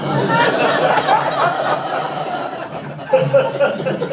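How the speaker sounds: long, high-pitched cackle; high cackling laugh. Real, not acted.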